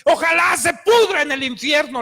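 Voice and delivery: loud, heated curse at the man; retold angry curse. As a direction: spit out the curse in fury. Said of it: "yo tomé un coraje hacia esa persona".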